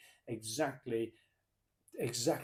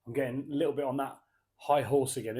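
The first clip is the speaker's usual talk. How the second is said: ordinary talk, nothing marked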